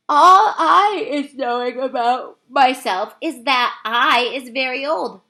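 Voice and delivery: put-on old giant musing; slow, wistful giant. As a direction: ponder it, slow and big. BFG musing on his age.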